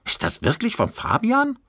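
high, incredulous cry; can't believe it's his; disbelieving call. Acted. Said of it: "rief eine andere ungläubig". (ungläubiger Ruf, deutlich höher)

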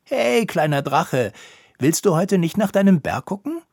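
a high, loud call up to the little dragon. Acted. Koníček calls from his hammock to Drago, loud call. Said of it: "rief Drago zu".

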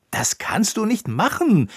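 put-on boy crying out at the elf, high and loud; exclaimed protest. A narrator's reading. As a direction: cry out in protest. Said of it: "rief Konicek".

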